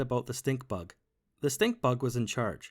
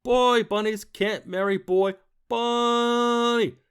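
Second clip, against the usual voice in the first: high, loud, slow furious villain; booming stink bug; 8 semitones higher, 7 dB louder, much slower than usual. A narrator's reading. Stink bug raging at the voters, slow booming fury. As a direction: hammer every word down. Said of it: "boomed the stink bug".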